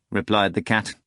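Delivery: ordinary delivery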